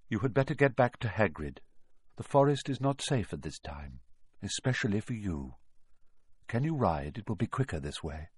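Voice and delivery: low, hushed, grave warning; a quiet, solemn centaur urging haste; calm centaur voice. Acted. Firenze warns Harry.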